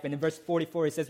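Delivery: ordinary talk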